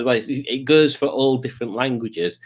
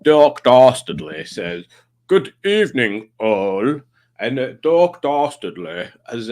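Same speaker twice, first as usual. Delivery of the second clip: villain persona; 5 dB louder than usual. Acted. Hosting as Doc Dastardly.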